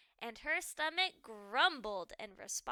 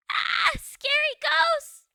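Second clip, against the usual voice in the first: high, loud, slow terrified shriek; scream; 13 semitones higher, 14 dB louder, slower than usual. Acted. Goldilocks waking to ghosts, terrified shriek. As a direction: shriek in fright.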